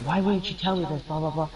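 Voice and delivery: lightly acted maternal upset; retold upset mom. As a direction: demand an answer as the upset mom, mildly. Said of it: "my mom got upset".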